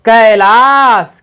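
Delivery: slow syllable-by-syllable Kailash; drawn-out reading. Shown as a demonstration. Teacher to child, reading Kailash slowly. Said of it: "तो अब पढ़ेंगे तो इसको क्या होगा".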